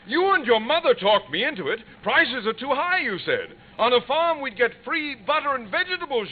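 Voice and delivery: mock-aggrieved recital; indignant mimicry. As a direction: throw their arguments back at them. Father mocks family's arguments.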